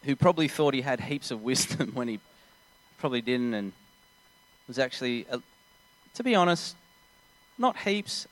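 ordinary talk

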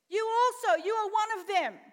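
loud, high accusation; accusing shout. Acted. Second accuser in Bible scene.

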